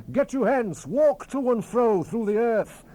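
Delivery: loud, high ringing command; commanding shout. Acted. The angel sends the horses across the earth, shouting. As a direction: send them off with a great cry.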